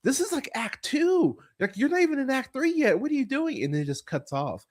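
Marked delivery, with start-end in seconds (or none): 0.0-3.6 high-pitched exasperated pleading; incredulous complaint. Retold or imitated. Frustrated viewer scolding the film, high and incredulous. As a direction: scold the movie in a high, disbelieving voice. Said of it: "And I was like".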